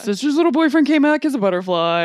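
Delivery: loud, high, eager announcement; excited improv character. Retold or imitated. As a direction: sell it like a wild reveal. Acting out improv scene.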